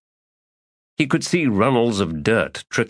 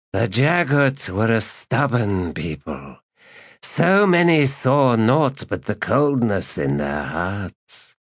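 undead dragon voice; 4 semitones higher than usual. Acted.

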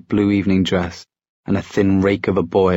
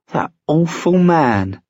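high, drawn-out disgust; indignant outburst; 8 semitones higher, slower than usual. A narrator's reading. Sophie condemning the consul's spying, indignant and high.

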